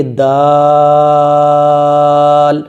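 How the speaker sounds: با and تا held long, one breath each; slow Quran teacher drawing out each letter; drawn-out letter names. Shown as a demonstration. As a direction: stretch با then تا out slowly. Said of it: "اس type سے".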